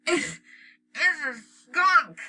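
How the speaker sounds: Dad holding his nose in alarm; held-nose voice. A narrator's reading. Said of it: "Dad said, holding his".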